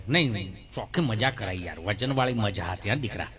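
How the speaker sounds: put-on low old villager's voice; old man voice. Old man thanking the young artist, low old voice.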